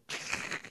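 short voiceless clattering rasp; imitated antler rattle. Shown as a demonstration. Hunter showing listeners the sound of bucks fighting, imitated rattle. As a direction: mimic antlers knocking with a mouth noise. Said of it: "it makes a little".

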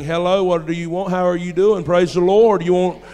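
loud, mock-friendly welcome at the door; sarcastic loud church greeting; mock-polite gun. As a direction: greet the intruder with false politeness. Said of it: "my gun saying".

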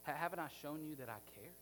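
soft, low and tender reassurance; gentle, kneeling Jesus. Retold or imitated. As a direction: kneel and speak eye to eye. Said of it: "in the opposite way".

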